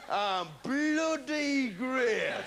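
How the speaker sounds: Geordie "I'm bloody great" stretched out slowly; slow, savouring Geordie boast. Acted. Catchphrase slowed the second time.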